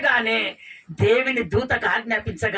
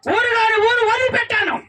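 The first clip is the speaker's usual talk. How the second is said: higher, louder sobbing wail; crying out; 12 semitones higher, 5 dB louder than usual. Acted. Hagar abandoned by husband crying to God, sobbing wail.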